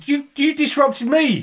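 high, drawn-out mock outrage; indignant protest. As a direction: turn the blame back, voice shooting up. Mock squabble over disrupting the show.

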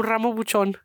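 Ramo Buchon with Spanish vowels and a tapped R; Spanish accent. Shown as a demonstration. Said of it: "Say that Mexican thing".

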